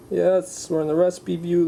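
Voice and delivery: normal talking voice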